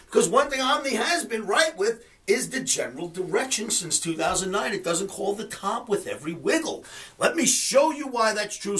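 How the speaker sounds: ordinary talk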